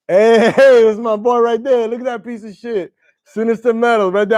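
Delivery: high, loud laughing hail to a friend; laughing greeting. Real, not acted. Greeting his buddy on screen, laughing and loud.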